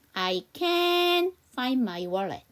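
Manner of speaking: very slow, can short and weak; slow demo. Shown as a demonstration. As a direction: stretch each word for the class. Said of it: "제가 천천히 이 문장 다시 한번 발음해 보면요". (천천히 발음 시범, can은 짧고 약하게)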